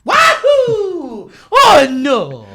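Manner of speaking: high shouted wahoo; squeaky cartoon plumber yelp; hammed-up Mario cry; Mario impression. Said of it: "he does that the whole time".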